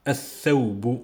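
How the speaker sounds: "Al-Thawbu" with L melted into a long th; no L in "Al-Thawbu", th held instead; silent L, doubled th. Shown as a demonstration. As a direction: say "Al-Thawbu" as ath-thawbu, leaning on the th. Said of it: "je dis pas Al-Thawbu".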